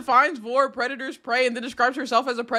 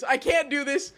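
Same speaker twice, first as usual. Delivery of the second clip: ordinary delivery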